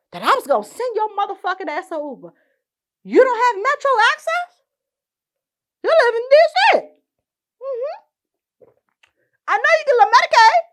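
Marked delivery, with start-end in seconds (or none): 3.0-10.6 high, loud, sarcastic questions; incredulous mockery. Real, not acted. Mocking the scammer's travel excuses, high and loud. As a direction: scoff at every excuse.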